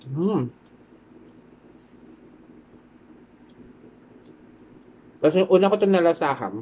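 talking while chewing; muffled chewing speech; mouth full of food. Real, not acted. Seen 2: Bites at -1, lips pursed chewing 1.3–3.7 while talking.

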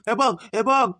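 ordinary delivery

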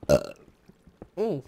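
usual speaking voice

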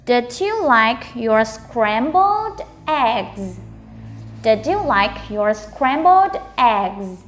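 very slow, word by word; slow model reading. Shown as a demonstration. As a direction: stretch each word out for learners to copy. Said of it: "Mình cứ đọc chậm từ từ thôi nhá". (đọc chậm từ từ)

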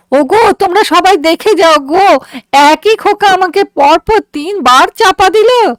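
put-on high-pitched old woman's hue and cry; acted wail of complaint; wailing outcry. A narrator's reading. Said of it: "বুড়ি কপাল চাপড়িয়ে চেঁচাতে লাগলো".

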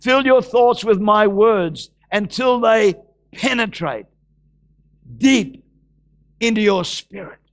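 slow, drawn-out scripture proclamation; preach. Acted. Preacher proclaiming scripture to the congregation, slow and declamatory. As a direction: declaim it solemnly, phrase by phrase. Reads scripture aloud at full force.

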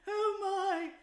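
high, loud wincing oh my; pained. A narrator's reading. Pained high moan. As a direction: mole moans over his cut shin. Mole in pain.

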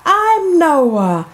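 high, bubbly welcome to the show; excited host greeting. Acted. Host welcoming kids back, bright and excited.

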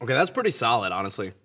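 talking between bites; chewing while talking. Real, not acted. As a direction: mouth partly full. Seen 1: Bit food at -2.0; jaw still working as he speaks.

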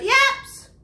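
high, loud panicked yell; zebra yelling. Acted. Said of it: "The zebra yelled".

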